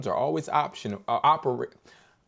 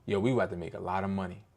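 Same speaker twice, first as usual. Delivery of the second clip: low confident line; 6 semitones lower, 6 dB quieter, faster than usual. Shown as a demonstration. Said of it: "I want you to say this".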